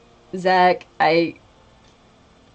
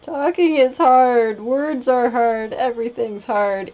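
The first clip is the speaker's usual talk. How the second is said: mock despair; 6 semitones higher, 4 dB louder than usual. Mock despair about talking.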